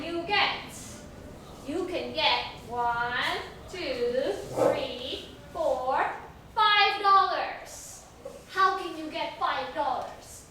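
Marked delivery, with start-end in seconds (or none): none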